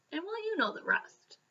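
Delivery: usual speaking voice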